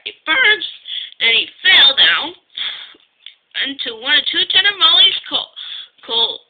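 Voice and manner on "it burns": high-pitched cry of pain. A narrator's reading. Painter complaining of burning paint, crying out. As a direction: yelp it out as the painter. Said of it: "he cried".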